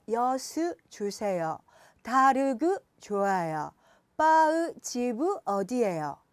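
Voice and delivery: slow foreigner's Korean, every consonant its own syllable; mock foreign-accented Korean, syllable by syllable; imitated foreigner. Foreigner asking in a shop, slow foreign-accented Korean. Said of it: "외국인이 와서".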